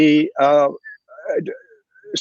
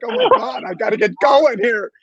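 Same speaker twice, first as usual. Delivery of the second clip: high, bursting "Oh my God", then eager; imitating his own rush of excitement; fired-up excitement; 8 semitones higher than usual. Agent fired up by the panel, blurting excited. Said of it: "I'm like".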